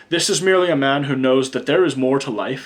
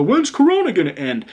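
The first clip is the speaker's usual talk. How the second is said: mock anxious client; 7 semitones higher, 3 dB louder than usual. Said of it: "Everybody wants to know".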